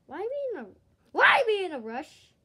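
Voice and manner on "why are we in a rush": loud complaint. Acted. Complaining loudly.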